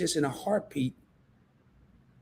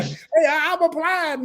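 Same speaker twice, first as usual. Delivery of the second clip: much higher, playful mock eager voice; mock applicant; 11 semitones higher, 3 dB louder, slower than usual. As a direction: play a hopeful suitor for laughs.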